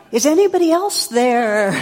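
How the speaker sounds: high, loud, incredulous call; desperate punchline. Retold or imitated. Hiker refuses God's advice and calls for another rescuer, high and loud. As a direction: shout past God for someone else. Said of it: "And the guy goes".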